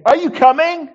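very high, loud vicar's holler; calling out. Acted. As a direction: call down the corridor to the family. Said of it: "And then he tries again".

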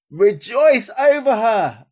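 triumphant slow cry; exultant call. Acted. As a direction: proclaim it in triumph.

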